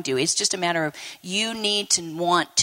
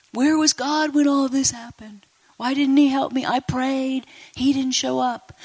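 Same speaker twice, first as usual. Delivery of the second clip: higher, mock-plaintive complaint; aggrieved questioning; 4 semitones higher than usual. Preacher voices those blaming God, aggrieved and higher. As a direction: voice a hurt believer's reproach, pitched up.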